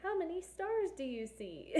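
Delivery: high, bright cartoon host asking the kids; chirpy children's-show question to the audience; sing-song Dora voice. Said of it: "I kind of felt like Dora there".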